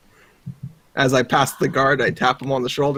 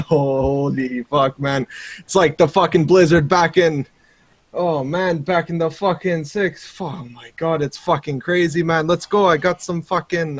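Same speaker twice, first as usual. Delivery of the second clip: exaggerated Canadian accent, rambling excitedly; Canadian accent slipping a bit toward Jamaican; rowdy Canadian rallying a buddy. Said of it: "my Canadian".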